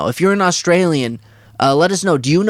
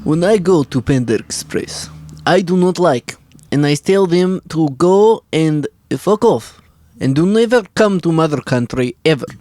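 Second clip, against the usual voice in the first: put-on Russian accent. Shown as a demonstration. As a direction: have a go at a thick Russian accent. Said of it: "You do it".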